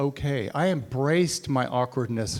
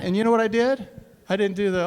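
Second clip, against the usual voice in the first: ordinary delivery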